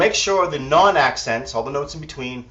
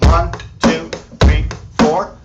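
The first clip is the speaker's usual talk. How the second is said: loud, raised count-in; counting the bar aloud, louder and higher; 4 semitones higher, 8 dB louder, slower than usual. Shown as a demonstration. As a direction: call out the beat over the drums. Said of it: "So one bar beat".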